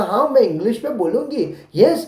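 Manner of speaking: slow, careful model answer; spelled-out teacher answer. Shown as a demonstration. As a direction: say it slowly so the child can copy. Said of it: "आप बोलना".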